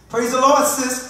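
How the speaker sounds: high, loud cheery hail; exaggerated public hello; put-on church greeting. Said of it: "In that deep voice".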